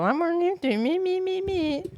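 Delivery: mocking singsong climbing high; self-mockery. Streamer mocking their own know-it-all line, mocking singsong. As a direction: parrot it back in a rising whine. Mocks own earlier boast.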